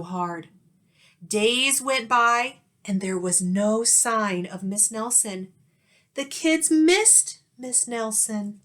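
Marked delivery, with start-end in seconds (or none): none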